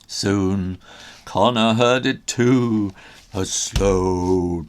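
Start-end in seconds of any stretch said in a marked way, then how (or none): none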